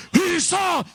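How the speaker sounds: loud, high mass reply; imitating a regiment's answering roar; troops shouting. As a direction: shout back like eight hundred soldiers. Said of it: "Ochocientos hombres gritando con fuerza".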